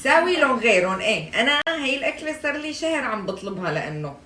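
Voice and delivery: loud, high teasing grievance; mock indignant complaint. Real, not acted. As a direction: complain loudly, half joking.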